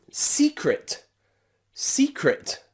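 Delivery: "secret" as SEE-cret, stress up front; stressed first syllable. Shown as a demonstration. Teacher gives learners another example, first syllable stressed. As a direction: punch the "see" high in "secret", then fall. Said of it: "one syllable is stressed".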